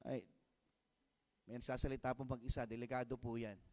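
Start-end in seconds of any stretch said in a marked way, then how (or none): none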